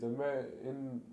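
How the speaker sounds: ordinary talk, nothing marked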